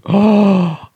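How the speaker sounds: sharp, loud delighted gasp; real thrilled intake of breath; excited gasp. Real, not acted. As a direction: gasp at the good news. Hearing she reinstated it.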